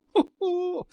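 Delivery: short high burst of laughter. Real, not acted. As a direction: break into a quick laugh. Host laughs mid sentence.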